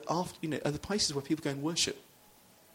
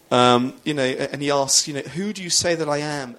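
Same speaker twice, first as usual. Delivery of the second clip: louder preacher voice, driving the point; full-force preaching; 9 dB louder than usual. Acted. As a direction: preach it out to the congregation.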